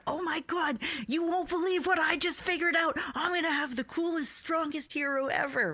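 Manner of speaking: put-on high-pitched kid excitement; a thrilled kid shouting to his dad; excited yelling. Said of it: "yelling at my dad".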